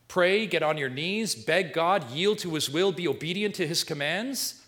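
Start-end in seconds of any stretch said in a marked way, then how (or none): none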